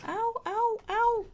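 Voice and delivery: high pained ow cries; yelping ow while under attack; being chased. Real, not acted. Game character hit while chased.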